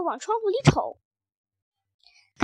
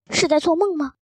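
plain reading voice

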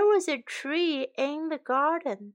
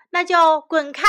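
loud, high order to leave; angry shout; 4 semitones higher, 8 dB louder, faster than usual. Acted. Shen tells the penniless beggar off, shouting. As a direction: shout them away. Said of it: "神大喊道".

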